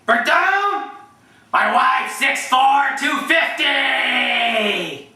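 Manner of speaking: high, drawn-out coach call; bellow-style call, stretching each number way out; Herd. Acted. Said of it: "Here's my herd impressions".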